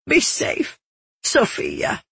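high, broken, half-voiced farewell; voice cracking. A narrator's reading. Woman bidding Sophia goodbye, voice cracking. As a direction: voice thinning and cracking as you plead. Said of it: "Her voice cracked".

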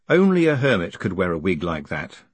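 usual speaking voice

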